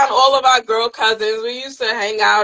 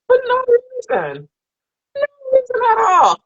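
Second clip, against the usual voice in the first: exasperated wail; 13 semitones higher than usual. Acted. Mother venting about her moody daughter, high hammed-up wail. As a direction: wail it like a fed-up mom near tears.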